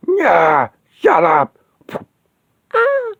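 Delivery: high, loud dismissive snarl; savage snarl. Acted. She-Hulk snarls at the Wasp before smacking her away. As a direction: shut her up with contempt.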